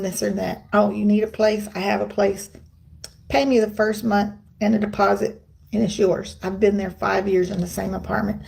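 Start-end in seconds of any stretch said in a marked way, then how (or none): none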